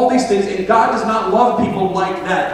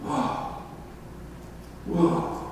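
soft, awestruck whoa; hushed amazement; 11 dB quieter than usual. Acted. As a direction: breathe it out as if left speechless. Acts out speechless amazement.

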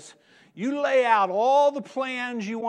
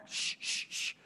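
unvoiced hushing; hushing shh; 9 dB quieter than usual. Owner hushing the dog, soft shh. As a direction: shush the barking dog.

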